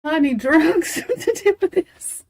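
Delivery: real laughter breaking up the words; laughing helplessly. Real, not acted. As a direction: say it through laughter. Helpless laughing fit during live stream.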